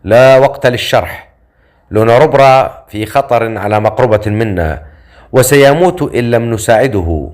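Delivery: ordinary delivery, nothing marked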